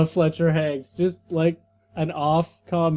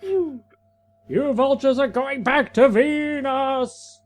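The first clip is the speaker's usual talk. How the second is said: hammed-up hero; 7 semitones higher, slower than usual. Hero voice in comic reading.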